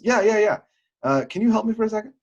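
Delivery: high, loud imitated plea for help; eager stranger. Stranger eagerly asks him for help.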